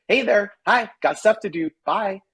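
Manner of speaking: cheeky puppy voice. Pretend. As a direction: toss it off like a dog zooming past. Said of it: "a bark that said".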